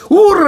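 loud, high lip-flapping babble; motorboat noise. Retold or imitated. Wanda's sister in the kitchen, motorboat sputter. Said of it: "starts going like a motorboat".

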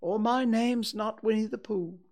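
a friendly, eager little bear; cheerful bear Pooh. Acted.

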